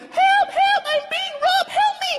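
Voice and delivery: very high, shrill falsetto cry for help; high-pitched, hammed-up screaming for help; screaming lady. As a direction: shriek it like a robbed woman. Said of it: "The poor lady was like".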